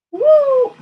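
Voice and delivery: high loud whoop; excited cheer. Real, not acted. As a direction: cheer loudly. Cheering after a score.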